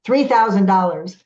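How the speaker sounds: loud blurted-out price, mock yell; retold yell. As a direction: call out the price like an eager friend. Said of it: "yells out".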